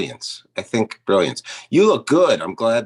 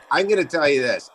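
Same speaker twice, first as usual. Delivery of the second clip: usual speaking voice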